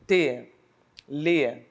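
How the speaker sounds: "telefone" as te... le... fo... ne, long gaps; very slow, syllable by syllable. Shown as a demonstration. Teacher showing four syllables, very slowly. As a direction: draw out and separate each syllable. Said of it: "se eu falar lentamente".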